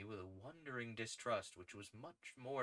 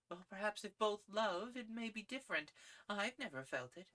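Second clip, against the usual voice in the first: put-on higher young woman's voice, calm and distant; a lady gently refusing; Gladys cool; 12 semitones higher than usual. A narrator's reading. Gladys answers coolly.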